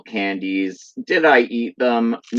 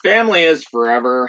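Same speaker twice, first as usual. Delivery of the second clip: loud, fast, higher movie-trailer read; trailer tagline; 6 semitones higher, 5 dB louder, faster than usual. Acted. Fan reading the box tagline to viewers, loud trailer style. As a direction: sell the tagline like a trailer announcer.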